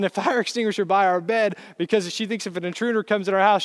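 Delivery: usual speaking voice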